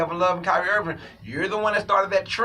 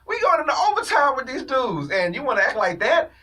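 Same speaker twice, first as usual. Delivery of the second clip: higher, disbelieving teammate voice; incredulous teammates; 7 semitones higher, 3 dB louder than usual. Retold or imitated. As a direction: act like you can't believe what you're seeing. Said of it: "they looked at that and said".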